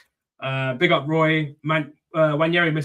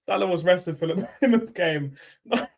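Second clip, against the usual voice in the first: laughing; 6 semitones higher, 4 dB louder, slower than usual. Real, not acted. Still laughing through words.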